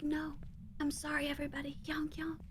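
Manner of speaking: whispering like her voice is gone; lost voice whisper. Retold or imitated. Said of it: "I'm pretty sure I whispered".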